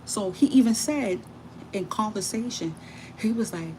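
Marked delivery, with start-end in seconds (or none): none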